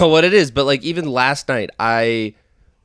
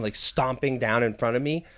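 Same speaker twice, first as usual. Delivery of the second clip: ordinary talk, nothing marked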